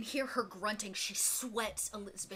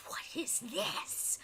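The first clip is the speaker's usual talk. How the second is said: eerie spirit voice; 5 semitones higher, 4 dB quieter, much slower than usual. Acted. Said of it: "you still hear that voice".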